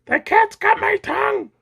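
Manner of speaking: very high cartoon squeal; squeaking. A narrator's reading. Butcher caught by the cat, panicked squeak. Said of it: "squeaked the butcher".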